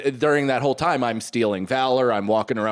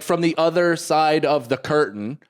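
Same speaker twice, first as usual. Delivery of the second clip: usual speaking voice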